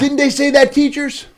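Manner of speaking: very high, loud plea for backup; hammed-up outraged question; mock indignant appeal. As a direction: call across the room for witnesses. Appeals to teachers in mock protest.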